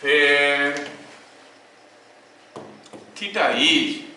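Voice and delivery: word by word, very slow dictation with long pauses; teacher dictating a law for notes. Acted. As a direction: dictate to students taking notes.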